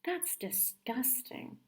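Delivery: slow, soft, disgusted sister. Acted. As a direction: drag it out in disgust. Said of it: "said Nancy".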